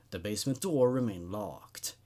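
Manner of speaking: ordinary delivery, nothing marked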